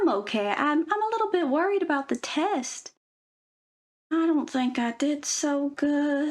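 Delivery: understated pretend worry, slowing at the end; mock worried girl. Girl worries about the test.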